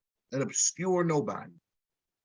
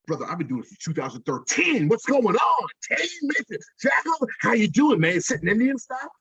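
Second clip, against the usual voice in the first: excited friend; 6 semitones higher than usual. Retold or imitated. Friend answers enthusiastically on phone.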